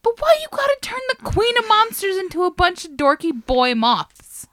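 high, loud mock outrage; indignant. Real, not acted. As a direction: protest it loudly in disbelief.

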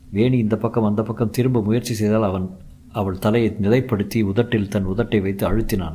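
usual speaking voice

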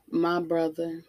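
ordinary talk

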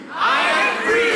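high, slow shout of freedom; shouted declaration. Real, not acted. Whole campus shouting back at pastor, slow joyful shout. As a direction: shout it with your chest. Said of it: "y'all better shout it out".

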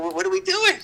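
high, incredulous squeak; exasperated disbelief. Retold or imitated. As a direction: cry out in disbelief. Exasperated at the front office.

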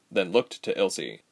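ordinary delivery

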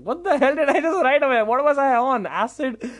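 high-pitched appalled outburst, easing off; cringing at himself. Retold or imitated. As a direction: berate yourself in disbelief. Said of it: "I cringe at myself".

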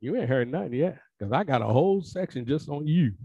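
ordinary talk, nothing marked

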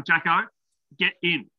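loud call across the house. Real, not acted. Calling child at the window.

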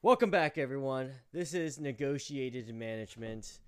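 big, slow podcast welcome; show announcer. Acted. Welcoming listeners back to the show, announcer voice.

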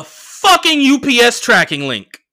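loud, high, drawn-out disbelief; outraged emphasis. Real, not acted. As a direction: blurt it out slowly and loudly, incredulous. Outraged at getting his things shipped.

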